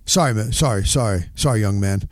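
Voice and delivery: mildly voiced PR guy saying sorry; low apologetic PR man; PR director. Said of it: "They wouldn't say dude".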